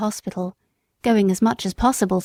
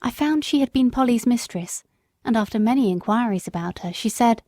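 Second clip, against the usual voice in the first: ordinary delivery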